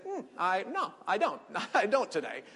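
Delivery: high, hesitant, laughing decline; sheepish laughing no; awkward laughing excuse. Pretend. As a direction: laugh off a coffee invite. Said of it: "And you might say".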